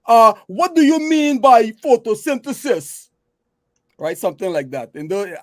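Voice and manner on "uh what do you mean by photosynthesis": put-on a loud, high, thick Guyanese accent asking the lecturer; a Guyanese student at the back calls out his question in his thick accent. Guyanese student in a lecture hall asking a question, thick Guyanese accent. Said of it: "my thick Guyanese accent".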